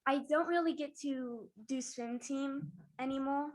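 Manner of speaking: normal talking voice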